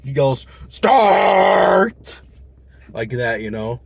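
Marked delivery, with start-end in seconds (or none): 0.7-2.2 sudden barked shout of one word; angry shout. Retold or imitated. Drive-thru worker yelling at customer, angry shout. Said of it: "he gets really upset with me".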